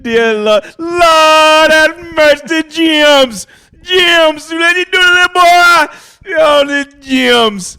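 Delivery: high, loud, drawn-out Jamaican wail; Jamaican accent. Pretend. Clowning for the co-host, exaggerated Jamaican accent. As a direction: shout it slowly in a hammed-up Jamaican accent. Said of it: "There you go with a Jamaican accent again".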